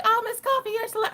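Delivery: high, swooning mock fan voice; gushing fan. Mocking chat fans calling her a celebrity, high and swooning. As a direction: coo it like a starstruck fan. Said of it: "So y'all are like".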